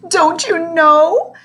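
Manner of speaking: high, slow, quavering plea; throbbing with emotion. Acted. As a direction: let the feeling swell. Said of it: "in a voice throbbing with emotion".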